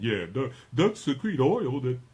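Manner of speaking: lightly voiced know-it-all caller; relayed off-mic voice. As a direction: repeat what he's telling you, a touch exaggerated. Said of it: "you can't hear him telling me that".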